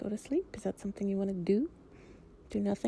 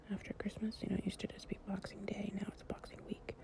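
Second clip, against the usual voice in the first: soft whisper; 12 dB quieter than usual. Acted. Lying close to a partner, drifting off, in a soft whisper.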